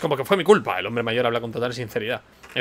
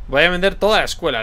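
defiant retort; 5 semitones higher, 6 dB louder than usual. Acted.

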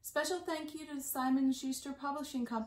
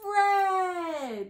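higher and louder, a bright exclaimed reveal; excited reveal; 5 semitones higher, 7 dB louder than usual. Acted. Librarian revealing the colour to young listeners, excited. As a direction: ring out the colour like a happy surprise.

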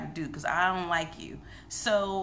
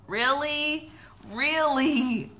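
emotional disbelief; 7 semitones higher, 4 dB louder, much slower than usual. Real, not acted. Reacting to emotional scene.

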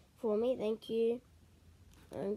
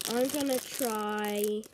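ordinary talk

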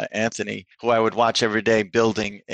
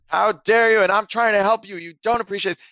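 furious father; 8 semitones higher, 3 dB louder than usual. Retold or imitated. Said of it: "his father gets furious".

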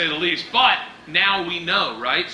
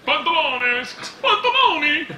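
Spanish then Italian; 10 semitones higher than usual. Acted. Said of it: "like Italian and Spanish".

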